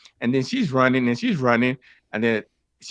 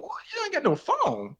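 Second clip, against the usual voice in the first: very high, disbelieving "you ain't got no phone"; incredulous squeak; 5 dB quieter than usual. Retold or imitated. Hearing she has no phone, incredulous high squeak. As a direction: pitch shoots sky high in disbelief.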